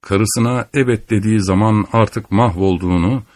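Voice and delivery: plain reading voice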